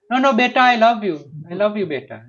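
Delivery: high, loud sing-song coo; doting parent. Retold or imitated. Parent coos, ignoring hungry baby.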